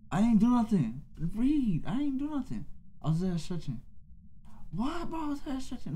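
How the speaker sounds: high, whiny protest of innocence; pleading innocence in a high put-on whine; mock protest. Friend accused of griefing, protests in a high whine.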